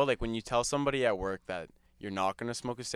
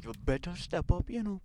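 higher, quiet scolding mum impression; scolding mother; 6 semitones higher, 4 dB quieter than usual. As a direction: imitate mum's warning, pitched up and held back. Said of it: "and she's just going".

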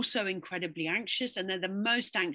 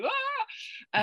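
panicked yelp. Retold or imitated. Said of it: "where it's like".

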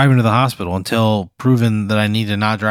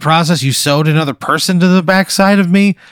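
higher, agitated confrontation; indignant complaint; 6 semitones higher, 3 dB louder than usual. Acted. Patient confronting doctor over sewn-on person, indignant. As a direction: pitch it up in outraged disbelief.

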